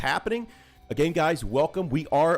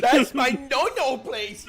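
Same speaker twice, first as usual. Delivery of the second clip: mock prudish whine; 13 semitones higher, 6 dB louder, slower than usual. Joking about the swab test.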